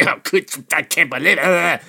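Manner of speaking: whiny imitation of outrage; lightly hammed-up indignant whine; mock indignant Lee. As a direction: mimic his huffy disbelief. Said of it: "voraciously indignant".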